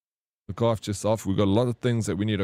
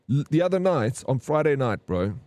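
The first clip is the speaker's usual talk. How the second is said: usual speaking voice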